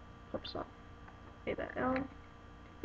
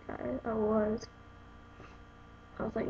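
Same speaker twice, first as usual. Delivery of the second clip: usual speaking voice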